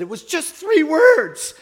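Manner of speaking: high, loud, drawn-out protest; mock-wailing, stretched high voice; imitated upset outburst. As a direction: imitate a distraught woman blurting it out. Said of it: "She was crying".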